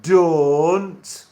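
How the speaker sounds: loud, long-vowelled Sheffield "don't"; Sheffield accent. Shown as a demonstration. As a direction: belt out "don't" in broad Sheffield. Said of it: "You would say it as".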